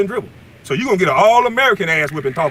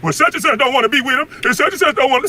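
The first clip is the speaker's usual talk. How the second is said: whiny mocking imitation; 8 semitones higher than usual. Ranting at a rival, mimicking his whiny gossip in a high sneer. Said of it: "sound like a brand new bitch".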